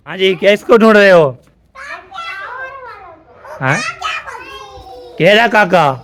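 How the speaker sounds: loud, high-pitched shout across distance; calling out. Real, not acted. Vlogger calls to people searching for Zainab, calling out loudly. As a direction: shout questions to someone far away.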